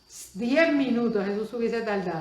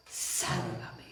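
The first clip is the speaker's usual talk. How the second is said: breathless whisper, much softer; faint whisper as if going under; whispered plea; 10 semitones lower, 8 dB quieter than usual. Retold or imitated. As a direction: let the plea sink to a whisper. Peter's plea fades under water.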